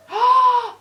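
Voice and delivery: loud high gasp of shock. Real, not acted. Owner spotting puppy peeing, shocked gasp. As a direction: caught him in the act.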